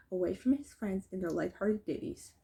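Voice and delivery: plain reading voice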